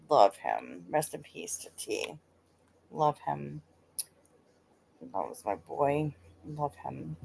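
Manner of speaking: low, soft words for a lost friend; tender remembrance. Real, not acted. Streamer remembering a friend who passed, tender and subdued.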